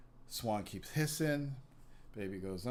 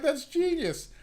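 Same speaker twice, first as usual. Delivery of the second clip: excited exclamation; 10 dB louder, slower than usual. Retold or imitated. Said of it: "And I'm like".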